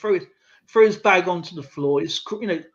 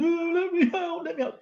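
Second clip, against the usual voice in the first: mock crying plea; 12 semitones higher than usual. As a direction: mimic a locked-in bully wailing to be let out. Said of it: "Aaron Spatman was crying".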